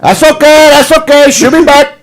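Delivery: high-pitched mock Cuban gangster; hammed-up Cuban accent, pushed high; Tony Montana. Gangster shrugging off a woman, high mock Cuban accent. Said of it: "let's do Tony Montana".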